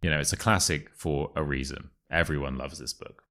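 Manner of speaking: ordinary delivery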